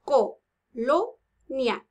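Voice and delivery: "Colonia" very slowly as co, lo, nia; "Colonia" counted out in three separate beats; syllable by syllable. Shown as a demonstration. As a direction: pause after each syllable of "Colonia". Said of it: "Contemos cuántas tiene colonia".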